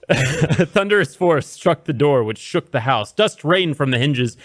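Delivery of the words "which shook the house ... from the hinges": usual speaking voice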